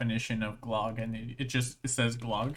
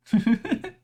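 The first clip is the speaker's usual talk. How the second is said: burst of laughter; 8 semitones higher, 7 dB louder than usual. Real, not acted. Reacts to word definition.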